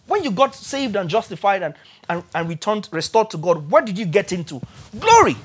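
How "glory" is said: high ringing shout of glory; sudden high exultant cry; exclaimed. Real, not acted. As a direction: burst out with the answer. Preacher exclaims answer.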